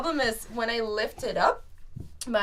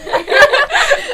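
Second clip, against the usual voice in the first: loud, high burst of laughter; 18 dB louder than usual. Real, not acted. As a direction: let a big laugh explode out.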